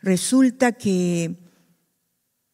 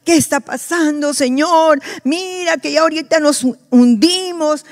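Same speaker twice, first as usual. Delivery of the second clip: high, raised panicked plea; panicked cry; 7 semitones higher, 5 dB louder than usual. Retold or imitated. Disciples panicking in the sinking boat, high and raised. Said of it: "se pusieron a gritar como locos".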